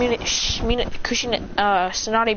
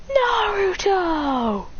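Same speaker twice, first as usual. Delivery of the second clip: long, drawn-out high cry of the name; slow, high gasp of recognition; stunned recognition; 10 semitones higher, much slower than usual. Acted. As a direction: stretch the name out in amazement. Mother recognises her lost son.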